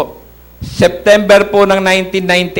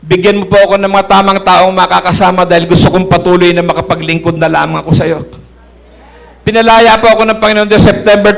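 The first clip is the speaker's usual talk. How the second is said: loud fervent testimony; impassioned preaching, louder; preaching at full force; 6 dB louder than usual. Acted. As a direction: pray it out loud before the church.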